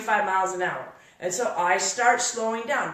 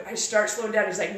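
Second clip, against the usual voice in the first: ordinary delivery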